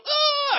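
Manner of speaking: high loud yelp of panic; panicked scream. Retold or imitated. Boy panicking under the dock, high scream. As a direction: shriek as if underwater terror hits. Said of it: "all of a sudden I go".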